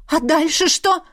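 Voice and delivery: high-pitched worried mother; anxious question. A narrator's reading. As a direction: demand it anxiously, voice lifted. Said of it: "тревожно спросила мама". (тревожно, высоким голосом)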